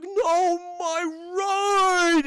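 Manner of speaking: high, very loud wail; hammed-up anguished scream; upset. [name] sees his car pancaked, wailing upset. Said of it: "He's so upset".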